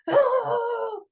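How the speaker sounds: put-on high playful child's roar; a child's sudden high little roar; high mock growl. Little boy showing stressed claws with a shriek.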